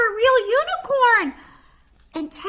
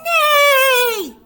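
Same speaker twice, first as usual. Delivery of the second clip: loud, high horse neigh; whinny like a proud unicorn; mighty neigh; 6 semitones higher, 10 dB louder than usual. Acted. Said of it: "He neighs a mighty neigh".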